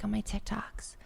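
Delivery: hushed, slow whisper; TikTok voice. Shown as a demonstration. Podcaster showing co-host her TikTok voice, whispering. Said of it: "I have a certain TikTok voice".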